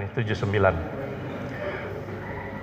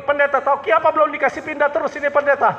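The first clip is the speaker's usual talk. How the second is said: complaining churchgoer; 10 semitones higher, faster than usual. Retold or imitated. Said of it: "Baku dapat ulang lagi".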